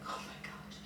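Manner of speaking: tiny voiceless whisper of despair; overwhelmed, barely audible whisper; near-tears whisper. Retold or imitated. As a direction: whisper it as if about to cry. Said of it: "at the point of tears".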